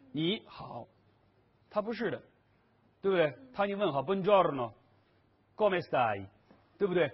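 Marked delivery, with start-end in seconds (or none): 4.0-6.3 slow Italian greeting, Buongiorno and Come stai with clear stressed syllables; Italian word stress. Shown as a demonstration. Teacher shows the student Italian stress, slow and marked. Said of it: "他是有语言逻辑重音的".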